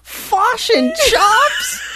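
high, drawn-out, incredulous "Fish and chops"; "Fish and chops" stretched slow and high, Kiwi vowels; mock New Zealand vowels. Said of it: "a New Zealander would say".

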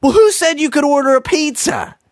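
high, nagging mom voice; mock mom. The mom scolding the kids over the pizza, high and nagging. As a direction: whine it like a scolding mother. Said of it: "then the mom says".

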